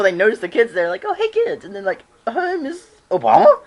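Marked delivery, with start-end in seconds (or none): 1.1-1.6 much higher, mock surprised hey; surprised greeting. Obama noticing the kids, mock surprise. 2.3-3.6 much higher, louder mock astonished hey; surprised greeting. Kids spotting Miss Obama, mock surprise.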